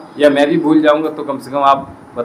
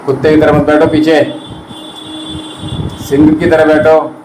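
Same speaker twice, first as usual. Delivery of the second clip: firm command; 3 semitones higher, 3 dB louder than usual. Real, not acted. Yoga teacher commanding posture.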